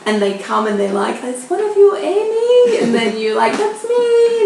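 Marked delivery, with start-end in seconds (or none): 1.2-2.8 high, loud sing-out question; mimicking a hopeful shout for a newcomer; calling out for someone. Imitates co-teachers calling out at pickup. 3.6-4.4 high, loud drawn-out reply; mimicked eager call-back. Imitates answering the pickup call.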